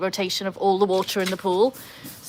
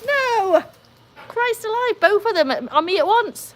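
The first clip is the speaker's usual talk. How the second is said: high, loud yelp of shock; startled outcry; 13 semitones higher, 5 dB louder, slower than usual. Real, not acted. Dogs shake water over her head, she yelps high and loud. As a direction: cry out as the wet dogs shake on you.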